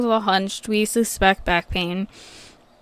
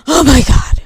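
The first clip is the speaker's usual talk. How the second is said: imitating a loud, higher exasperated groan; mock his overdramatic despair, louder and higher; exasperated outburst; 5 semitones higher, 10 dB louder than usual. Imitating the older client's tantrum over the pharmacy, loud and higher. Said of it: "And he goes".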